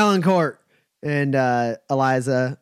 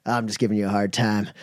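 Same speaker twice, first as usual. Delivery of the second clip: quick, low tease; 5 semitones lower, faster than usual. Acted. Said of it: "He goes".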